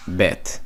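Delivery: Bet ending on a crisp hard t, no th. Shown as a demonstration. Teacher showing the modern way to say Bet. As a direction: snap Bet shut with a plain t. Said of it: "is how people say it now".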